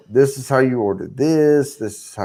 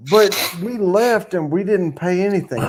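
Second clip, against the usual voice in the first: higher, baffled objection; retold protest; 7 semitones higher than usual. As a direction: replay your disbelief to the translator. Acts out his protest to translator.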